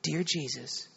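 lightly voiced mother giving a prayer line; retold prayer, soft and low, spoken for repeating; mother leading prayer. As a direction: lead a child gently in prayer. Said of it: "You just pray after me".

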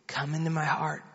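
lightly voiced mother giving a prayer line; retold prayer, soft and low, spoken for repeating; mother leading prayer. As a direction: lead a child gently in prayer. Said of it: "You just pray after me".

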